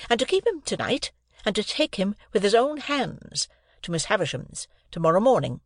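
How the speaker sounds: high reproving lecture; reproachful. A narrator's reading. Sister reproaching Joe about the boy. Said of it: "still in a reproachful manner".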